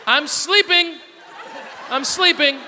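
put-on loud, high child calling out; imitating small daughter. Daughter fooling parents from behind door, calling out. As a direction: call it through the door like a sly toddler. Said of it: "she goes".

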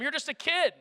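high, loud scoffing; mocking soldiers. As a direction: laugh the boy off. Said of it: "Everyone laughs at him".

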